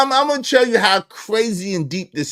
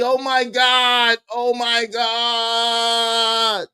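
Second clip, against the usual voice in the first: mock dismay; 4 semitones higher, much slower than usual. Real, not acted.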